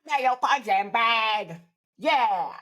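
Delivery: a lightly voiced scrappy grandma; grandma repeating her new anthem; imitating grandma. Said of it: "she was like".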